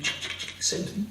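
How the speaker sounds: soft, hushed "ch-" swishes; little noises copying shoes being moved; imitated sliding noise. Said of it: "The companions saw him".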